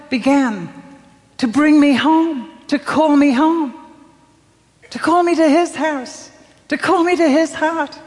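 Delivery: high, ringing repeated phrases; impassioned preaching. Real, not acted. Preacher testifying how God called her, impassioned.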